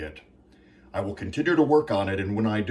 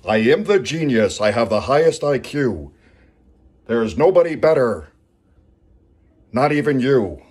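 louder, boastful genius proclamation; Lanny Poffo impression; 6 dB louder than usual. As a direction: boom it out like a cocky wrestler. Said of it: "do a Lanny impression".

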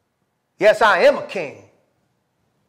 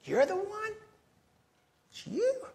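incredulous; 5 dB quieter than usual. Acted. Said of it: "That's the emphasis".

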